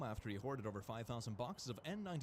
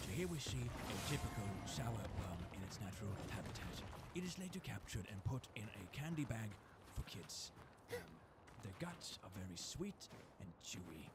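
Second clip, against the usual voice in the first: nature documentary; 4 semitones lower than usual. Pretend. Documentary parody of sour worm.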